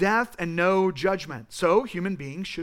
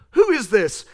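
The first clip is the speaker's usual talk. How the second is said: sharp, loud scoffing question; scoffing crowd; 3 semitones higher, 8 dB louder than usual. Retold or imitated. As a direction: bark it like a sneering crowd in Athens. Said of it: "and they go".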